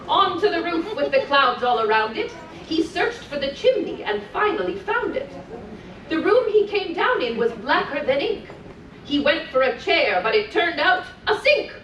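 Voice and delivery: put-on lilting read-aloud for small children; sing-song storytelling voice; storybook reading. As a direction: read it like a bedtime story to little ones. Reading continues.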